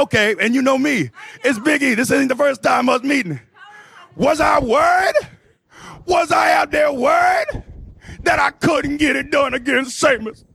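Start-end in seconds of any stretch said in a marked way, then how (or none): 4.2-10.4 raised pitch, incredulous rant; hammed-up scornful disbelief; mock indignation. Acted indignation at being doubted.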